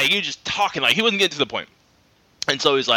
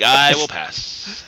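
slow polite refusal; 3 semitones lower, much slower than usual. Retold or imitated.

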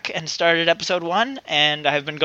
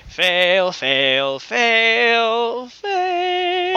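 each fail climbing higher; sing-song fail; 5 semitones higher, much slower than usual. Pretend. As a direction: chant fail rising in pitch. Mocking own failure.

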